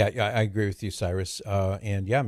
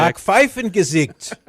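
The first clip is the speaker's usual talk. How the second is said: Backpfeifengesicht loud, slow and higher in mock German; faux German accent; 9 semitones higher, 8 dB louder, slower than usual. Shown as a demonstration. Host repeating his favorite word to cohost, faux German accent. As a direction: savor Backpfeifengesicht like a proud German. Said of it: "I like saying this".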